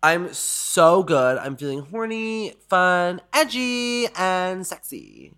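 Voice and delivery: high, slow, playful list; flirty tease. Acted. Host answering the caller with a flirty slow drawl. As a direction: purr it out teasingly.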